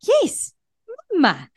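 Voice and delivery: delighted parent reply. Shown as a demonstration. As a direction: answer your baby with joy. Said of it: "and I go".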